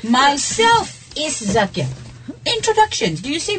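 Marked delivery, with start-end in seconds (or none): 0.1-1.9 slow and higher, deliberate quoted error; slow example sentence. Shown as a demonstration. Teacher repeating the faulty introduction for students, slow and deliberate. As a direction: raise the pitch and spell out each word slowly. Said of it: "I'm gonna say the, the, the statement".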